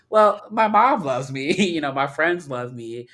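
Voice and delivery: high, loud, drawn-out comeback; slow, sing-out self-defense; playfully defiant. Acted. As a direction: stretch it out like a proud retort. Defiant retort to online critics.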